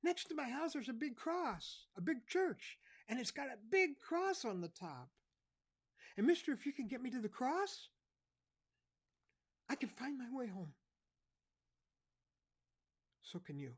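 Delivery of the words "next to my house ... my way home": put-on very high, loud child's voice; lost boy telling the cop about the church; sniffling little boy. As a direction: play a tearful small boy. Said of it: "he sniffed and he said".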